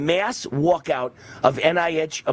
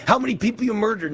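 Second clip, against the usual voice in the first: angry shout; 7 semitones higher than usual. Real, not acted. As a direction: yell the insult at him. Ranting at a political opponent.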